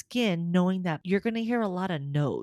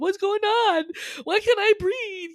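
high, loud panicked gasping question; retold panic attack, high and loud; 7 dB louder than usual. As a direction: relive the moment you couldn't breathe. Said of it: "because I was scared".

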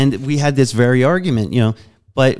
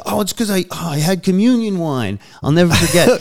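mocking defensive excuse; 7 semitones higher than usual. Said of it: "he's like".

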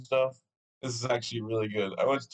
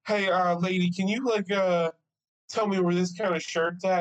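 frazzled asking; 6 semitones higher than usual. Retold or imitated. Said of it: "I'm like".

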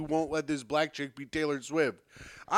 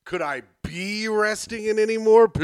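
louder Chandler-style sarcasm, stress on "be"; Matthew Perry impression; 4 semitones higher, 6 dB louder than usual. Host's second try at the Chandler line, louder. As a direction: give "Could I be resting in any more piss?" the sitcom lilt, louder. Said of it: "That was better".

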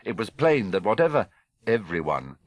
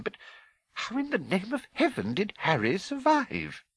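high-pitched, broken disbelief; incredulous and overwhelmed; McGonagall, astounded; 13 semitones higher than usual. A narrator's reading. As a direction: marvel in a very high, halting voice.

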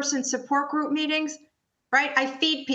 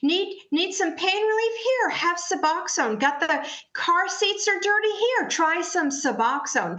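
higher, mocking sales pitch for Suboxone; mock glamorizing; 6 semitones higher than usual. Mocking how the country pushes Suboxone, sing-song sarcastic pitch. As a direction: sell it breezily, mocking the hype. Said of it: "we've glamorized Suboxone".

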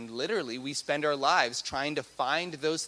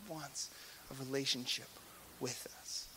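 hushed; 14 dB quieter than usual. Real, not acted. Sermon falls to a hush.

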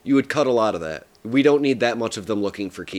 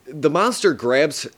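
ordinary delivery

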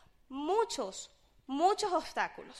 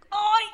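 overcome cry; 7 semitones higher, 10 dB louder than usual. Retold or imitated. On the floor, overcome.

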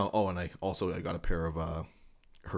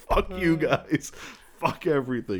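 so mad; 9 semitones higher, 6 dB louder than usual. Retold or imitated. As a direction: imitate a furious dad who's been pranked. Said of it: "He was so mad".

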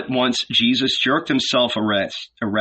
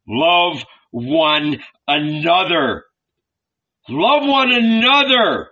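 pleading, higher, louder; imploring; 6 semitones higher, 4 dB louder, slower than usual. A narrator's reading. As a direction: plead with the crowd persistently. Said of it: "persistent, imploring voice".

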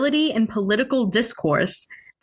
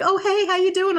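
cheery neighbor; 11 semitones higher than usual. Retold or imitated. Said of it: "They'll be like".

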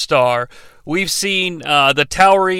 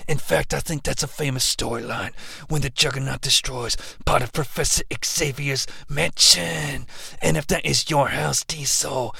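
gravelly promo voice; 5 semitones lower, 8 dB quieter than usual. Acted. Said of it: "Juggernaut promo".

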